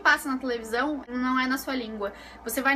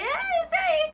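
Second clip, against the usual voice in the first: much higher, flustered pointing; squeaky pick; much slower than usual. Retold or imitated. Nervous customer points at a ham, much higher flustered squeak. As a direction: squeak it out, unsure. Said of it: "Eu falei".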